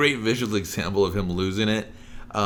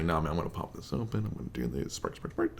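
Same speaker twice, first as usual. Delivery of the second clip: lower, softer, quick muttering fading to unvoiced; mock calm muttering; 6 semitones lower, 9 dB quieter than usual. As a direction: mumble to himself while working. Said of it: "He's like".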